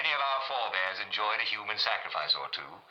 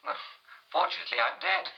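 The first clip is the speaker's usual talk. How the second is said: much higher, haughty royal lady; Queen Mary. Acted. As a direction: ask it like a queen looking down. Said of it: "Her Majesty Queen Mary".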